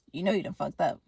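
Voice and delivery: low, soft retold threat; quiet menace. Killer to the artist at the opened door, quietly menacing.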